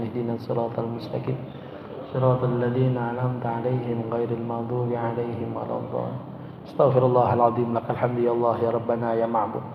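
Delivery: fast, practiced Arabic recitation; quick rhythmic liturgical chant; Quran recitation. Acted. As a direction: rattle off the familiar verses devoutly. Recites Al-Fatihah and closing du'a.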